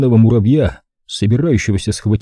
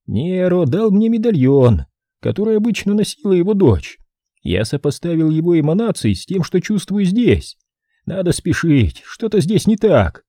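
woman's voice; 8 semitones higher than usual. Acted. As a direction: voice her urgent and uneasy. Woman speaking in the story.

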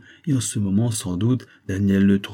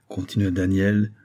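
ordinary delivery, nothing marked

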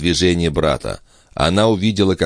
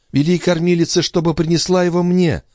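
put-on young mother voice; 12 semitones higher than usual.

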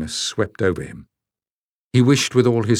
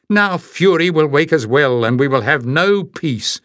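higher, louder rising scold; 7 semitones higher, 4 dB louder than usual. A narrator's reading. Jasmine keeps scolding Barda, higher and louder. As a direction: press the complaint harder. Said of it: "Jasmine scolded".

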